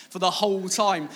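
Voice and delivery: ordinary delivery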